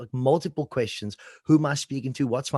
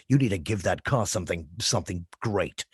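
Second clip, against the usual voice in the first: much lower voiceover delivery; dramatic ad voice; 7 semitones lower than usual. Shown as a demonstration. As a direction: drop deep to sell the car. Slipping into the car-ad voice.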